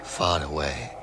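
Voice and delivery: usual speaking voice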